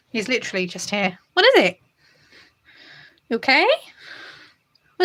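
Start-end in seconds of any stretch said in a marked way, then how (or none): 1.3-5.0 high cooing pet talk. Real, not acted. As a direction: coo up high at a beloved cat. Cooing to cat.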